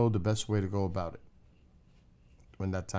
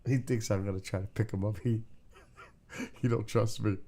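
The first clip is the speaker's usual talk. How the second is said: usual speaking voice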